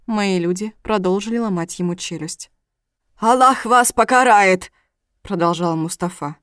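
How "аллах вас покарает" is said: loud, slow, high-pitched curse; shouted curse. A narrator's reading. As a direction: hurl it at the captors as a threat. Captive curses his captors. (громкое, гневное проклятие)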